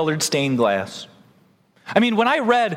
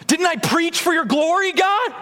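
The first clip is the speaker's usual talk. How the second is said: indignant plea; 11 semitones higher than usual. Acted. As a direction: insist you earned it. Acted plea to God at judgment.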